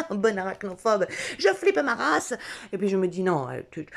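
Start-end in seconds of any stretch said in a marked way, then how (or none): 0.0-2.3 laughing. Real, not acted. Laughing about her spider fear.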